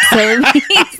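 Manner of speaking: ordinary talk, nothing marked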